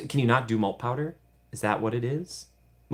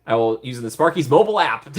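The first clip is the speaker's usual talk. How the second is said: usual speaking voice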